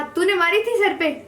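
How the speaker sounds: a staged scolding for the neighbours; stern question. Retold or imitated. Father questioning his daughter before the other parents, mock stern. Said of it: "कहा".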